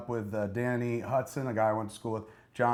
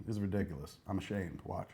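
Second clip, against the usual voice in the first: ordinary talk, nothing marked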